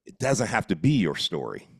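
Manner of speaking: ordinary talk, nothing marked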